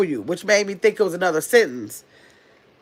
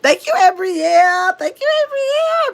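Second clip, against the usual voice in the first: squeaky high thank-you; 11 semitones higher, 3 dB louder than usual. Pretend. Thanking Abrielle for the gift in a squeaky high voice.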